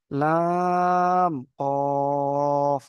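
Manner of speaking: very slow recitation of letter names; drawn-out letter recitation. Shown as a demonstration. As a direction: stretch each letter name long and sung. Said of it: "কফটা পুর হরুপ".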